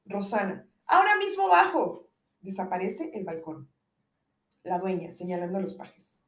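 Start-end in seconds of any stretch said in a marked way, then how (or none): 0.9-2.1 loud, higher call from above. Acted. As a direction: call down to him eagerly. Calling down from the balcony.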